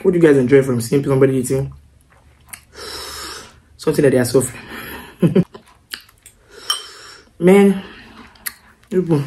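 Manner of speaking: speaking between bites; talking while chewing. Real, not acted. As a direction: mouth partly full of food. Seen 0: Noodles eaten just before; jaw and lips chewing while she talks.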